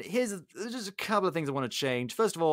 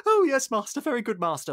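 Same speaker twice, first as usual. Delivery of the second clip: servile yes-man; 9 semitones higher, 5 dB louder than usual. Retold or imitated. Fawning servant Cogsworth.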